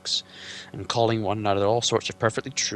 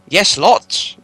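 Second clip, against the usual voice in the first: higher, louder eager hobbit; Bilbo blurting; 10 semitones higher, 14 dB louder than usual. Acted. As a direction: blurt it without thinking.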